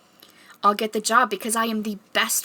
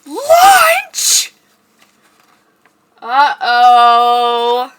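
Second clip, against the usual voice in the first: mouse shriek; 3 semitones higher, 14 dB louder, much slower than usual. Pretend. Mouse panics.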